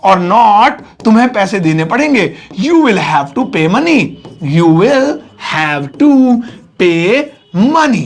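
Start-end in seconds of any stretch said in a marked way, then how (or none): 4.5-8.0 slow words, each set apart by pauses; word-by-word dictation. Shown as a demonstration. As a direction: dictate it slowly while writing. Dictating word by word while writing. (एक-एक शब्द रुक-रुककर लिखवाते हुए)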